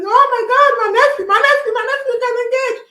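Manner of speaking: very high, loud excited cry; retold yelling. Sister announcing nephew's engagement, yelling. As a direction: shriek the big news. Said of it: "she stopped yelling".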